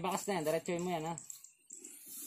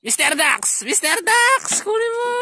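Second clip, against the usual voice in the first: calling out; 4 dB louder, slower than usual. Real, not acted. Calling out across field.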